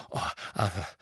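desperate. A narrator's reading. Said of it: "sagte er verzweifelt".

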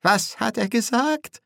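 high, vibrating frightened voice; quavering fear. A narrator's reading. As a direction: squeak the question out nervously. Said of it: "brachte Nobby mit vibrierender Stimme hervor".

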